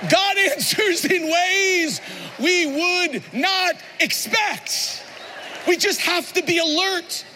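slow, high, shouted preaching; preaching at full force. Acted. Pastor hammering the point to his church, shouting. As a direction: drive it home to the congregation.

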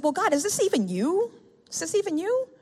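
high questioning plea; doubting. Retold or imitated. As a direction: ask high and unsure. Questioning God in retold prayer.

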